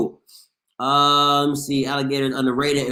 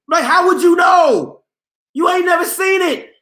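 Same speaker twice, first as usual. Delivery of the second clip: indignant shout; 12 semitones higher, 5 dB louder than usual. Real, not acted. Arguing with a Southerner about snow.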